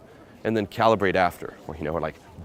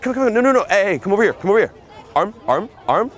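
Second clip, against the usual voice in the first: high, loud, insistent stream of orders; commanding, fun, urgent; 11 semitones higher, 6 dB louder than usual. Shown as a demonstration. Pickup coach demonstrating the tonality he wants, urgent command. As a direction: keep ordering her over with playful urgency. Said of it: "that's the tonality you wanna have".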